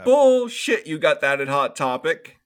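loud, high burst on the first word; acted disbelieving retort. Scoffing at a claim about a Hot Topic doll, loud mock disbelief.

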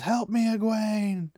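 a high, slow, drawn-out cry for help; desperate call to Egwene, high and slow; retold yell. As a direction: call out to her friend, stretching it. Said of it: "she just yells".

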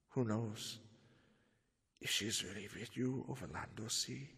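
low, near-whispered taunt; hushed challenge. Acted. Van Helsing softly taunts the absent Count Dracula. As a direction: murmur it like a secret dare.

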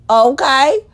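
normal talking voice

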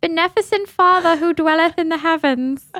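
high-pitched, hammed-up presidential proclamation; Abraham Lincoln. Cohost asked for a president's voice on the proclamation, high-pitched impression. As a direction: put on a high, old-time orator's voice. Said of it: "in your Abraham Lincoln voice".

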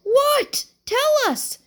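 very high, loud eager plea; impatient demand. A narrator's reading. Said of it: "said Buddy impatiently".

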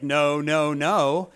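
loud firm no, no, no; firm refusal. Retold or imitated. Joseph rejecting Potiphar's wife, loud and firm. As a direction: push her away with a loud no.

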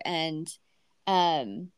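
usual speaking voice

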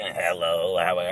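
very low, monotone mock voice; put-on deep voice. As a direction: drop way down into a fake deep greeting. Imagines voice after surgery.

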